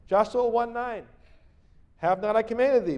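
loud, high, proclaiming Bible read; scripture reading. Acted. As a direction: declaim the verse to the congregation. Preacher reads scripture aloud.

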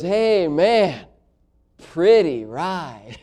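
slow, drawn-out admiring stranger; odd stranger. Retold or imitated. Stranger admiring the preacher's old car, slow and odd. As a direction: draw out pretty ride, odd and slow. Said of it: "He goes".

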